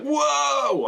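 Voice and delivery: high, drawn-out gasp of amazement; awestruck whoa. Retold or imitated. Fans spotting a major celebrity, hammed-up awe. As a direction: stretch it out high, hugely impressed. Acts out crowd seeing Tony Stark.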